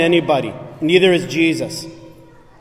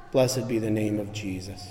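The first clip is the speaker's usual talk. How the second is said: much lower and softer, a hushed prayer; reverent blessing; 5 semitones lower, 12 dB quieter than usual. Acted. Preacher closing with a blessing for the congregation, hushed and low. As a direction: murmur it as a prayer of devotion.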